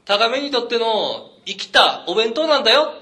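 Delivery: hammed-up inner cry at the kid; inner shout to the kid. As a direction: shout it in your head at the kid. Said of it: "俺心の中で叫んだけども".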